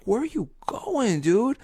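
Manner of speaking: high-pitched, tearful-style whine; mock bawling. Crushed kid asking the TV host why he leaves, mock bawling. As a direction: wail it in hammed-up dismay. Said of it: "bawling at this point".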